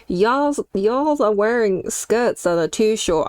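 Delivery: mock Southern American accent, slow, drawn out. School teachers scolding girls about short skirts, mocked in a Southern American accent.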